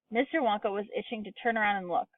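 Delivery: ordinary delivery, nothing marked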